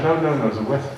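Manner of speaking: high, loud, laughing objection; mock protest. Jokingly protests about his accent.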